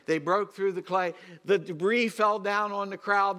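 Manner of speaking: emphatic pulpit narration; forceful preaching. Real, not acted. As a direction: drive the scene home to the congregation. Preaches the roof scene at full force.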